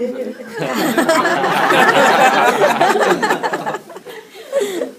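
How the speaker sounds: big, high, loud laugh breaking out; loud burst of laughter. Real, not acted. Lecturer cracking up at a student's cocky answer, loud laughter. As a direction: laugh out loud, can't hold it in.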